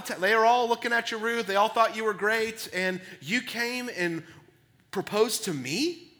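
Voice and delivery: can't believe his luck, near shouting; shocked delight. Retold or imitated. Boaz overwhelmed that Ruth proposed to him, excited amazement. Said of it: "he's shocked".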